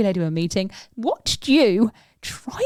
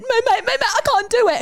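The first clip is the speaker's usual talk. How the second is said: high-pitched, frantic refusal, hammed up; mock panic; 14 semitones higher, 3 dB louder, faster than usual. Retelling how the friend balked at the door, mock panicky. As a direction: squeal it in mock panic, much higher than usual.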